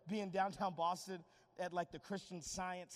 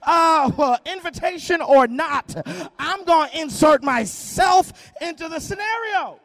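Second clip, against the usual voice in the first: loud, rising preacher's call; loud impassioned sermon delivery; full-force preaching; 6 semitones higher, 22 dB louder than usual. Acted. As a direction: preach it hard to the room.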